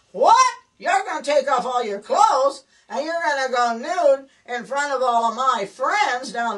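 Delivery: husband slamming down the paper, mock shock. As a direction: jolt out of the newspaper in mock alarm. Husband finally reacts, shocked.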